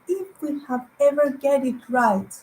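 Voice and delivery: ordinary delivery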